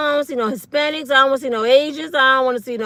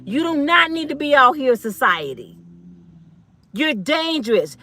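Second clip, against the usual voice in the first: ordinary talk, nothing marked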